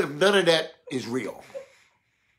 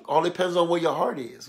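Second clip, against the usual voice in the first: usual speaking voice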